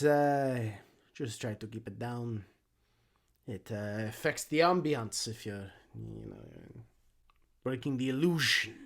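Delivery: flustered, obliging busybody gnome; accented gnome, halting and confidential; gnomish exhibitor. Acted.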